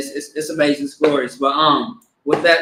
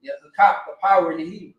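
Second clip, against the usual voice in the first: chanted, line-by-line prayer for the group to repeat; steady devotional call and response; prayer leading; 3 semitones higher than usual. Real, not acted. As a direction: lead the prayer one phrase at a time.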